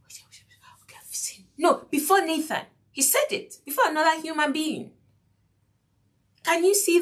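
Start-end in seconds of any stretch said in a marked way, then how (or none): 0.1-1.5 hushed, breathless mock confession; mock whisper. Preacher mocks a secret confession, whispered. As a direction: whisper it like hiding in a corner. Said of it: "to whisper and say".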